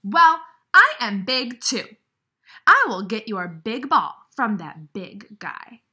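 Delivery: a big friend getting worked up on Piggy's behalf; indignant Gerald. Acted. Gerald vows to get ball.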